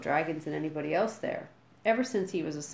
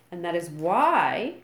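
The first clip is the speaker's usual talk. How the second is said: slow and loud, rising to why; full volume; 7 dB louder, slower than usual. A narrator's reading. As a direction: boom it across the beach. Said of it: "continued Gobber at full volume".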